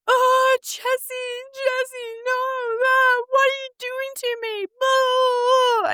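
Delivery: high-pitched, over-the-top imitation of a wailing man; melodramatic Danny wail. Imitating the distraught partner on the phone, melodramatic wailing. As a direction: wail it like a really bad telenovela, hammed up. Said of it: "it sounds so melodramatic".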